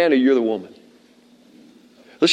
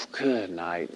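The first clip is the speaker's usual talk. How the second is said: very low, soft exhaled words; hushed retold disbelief; dismayed exclamation under breath; 8 semitones lower, 13 dB quieter than usual. Exasperated at the rising storm.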